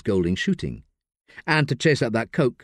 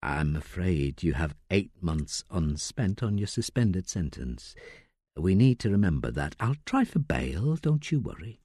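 much softer, low reassuring solicitor; soft, gentle; 5 semitones lower, 6 dB quieter than usual. Acted. Solicitor softly warning Layla about her suspended sentence. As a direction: break bad news gently. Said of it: "says softly".

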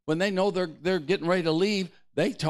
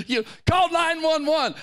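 mock panic; 12 semitones higher, 5 dB louder than usual. Mocking panicked reaction to demon.

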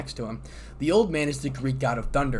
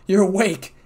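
relieved and loud; excited; 7 semitones higher, 9 dB louder than usual. A narrator's reading. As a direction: burst out with relief. Relieved outburst at her waking.

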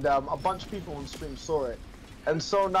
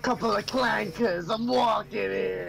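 put-on higher, slow New York accent swagger; 6 semitones higher than usual. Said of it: "Say it with, like, a New York accent".

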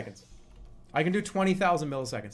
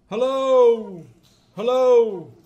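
loud, high, drawn-out call; 4 semitones higher, 11 dB louder, much slower than usual. Shown as a demonstration. Testing added echo on the voice, loud calling. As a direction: holler it out to hear an echo.

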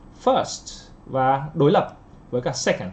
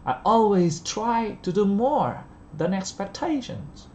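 always and more hit hard, pause after each stress; slow stressed model reading; 6 semitones higher, much slower than usual. Shown as a demonstration. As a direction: read it slowly, pausing after the stressed words. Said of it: "thầy dừng lại một chút ở sau trọng âm". (đọc chậm, nhấn mạnh và ngừng sau trọng âm)

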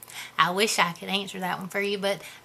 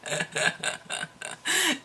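breathy giggle; 7 semitones higher, 8 dB quieter than usual. Real, not acted. Laughs at own joke.

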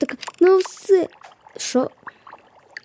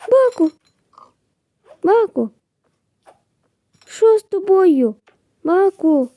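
high, alarmed calling out; worried high shouts of the name; 5 semitones higher than usual. Real, not acted. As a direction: call out to a hurt friend. Friend found hurt.

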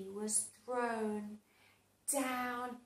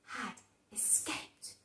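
whispered; 2 semitones lower, 7 dB quieter than usual. A narrator's reading. Suspense narration.

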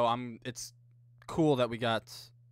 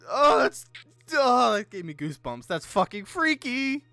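rattled; 8 semitones higher, 9 dB louder than usual. Real, not acted. Shaken after the jump scare.

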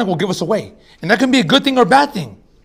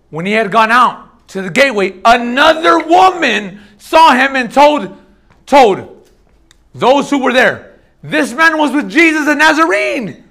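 higher, louder, slowed preaching read; forceful scripture reading; 4 semitones higher, 4 dB louder, slower than usual. Acted. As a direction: proclaim the verse to the room.

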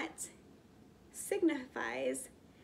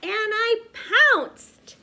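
much higher and louder, slow and stretched; triumphant pounce; 11 semitones higher, 13 dB louder, much slower than usual. Acted. Child pouncing on the dog, big triumphant cry. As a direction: leap at the dog with the whole voice.

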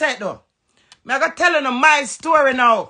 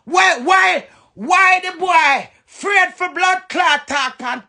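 normal talking voice

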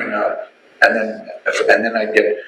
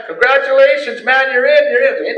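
much higher, bright and excited; cheerful congratulations; 13 semitones higher, 3 dB louder than usual. Retold or imitated. Church members welcoming him, excited and high.

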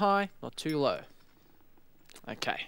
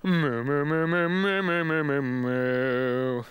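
mooing warm-up; 6 semitones lower, slower than usual. Shown as a demonstration. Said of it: "I'll just warm my voice up first".

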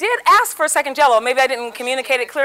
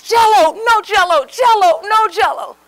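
louder and higher, tossing the words back and forth; husband and wife bickering over Jell-O, raised voices; mock quarrel; 6 semitones higher, 6 dB louder, slower than usual. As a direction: play both sides of a shouting match. We are at each other's throats.

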